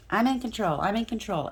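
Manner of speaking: mocking the controlling ex. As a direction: sneer it like the ego who must be in charge.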